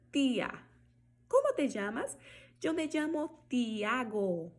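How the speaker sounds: TIA, te, Tiago with clean T, never "chi"; hard T. Shown as a demonstration. Teacher giving T examples, unsoftened T. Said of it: "você vai falar ti".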